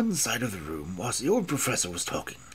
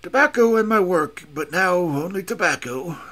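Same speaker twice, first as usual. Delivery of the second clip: loud, higher rueful exclamation on Tobacco; exclaimed; 4 semitones higher, 6 dB louder than usual. Acted. Old professor lamenting his lost assistant to Holmes, exclaiming. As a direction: burst out with it, then sink back. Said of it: "the old man exclaimed".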